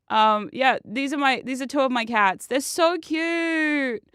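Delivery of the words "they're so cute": higher, very drawn-out gushing; real doting squeal of words; adoring coo. Real, not acted. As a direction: melt over your pets, stretching it out. Adoring photo of own cats.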